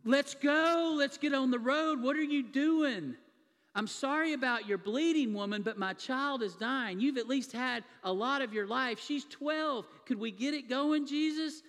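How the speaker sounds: loud, high, urgent pleading to hurry; impatient pleading. Retold or imitated. Father begging Jesus to hurry for his dying daughter, loud and urgent. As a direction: push hard, a desperate father out of time.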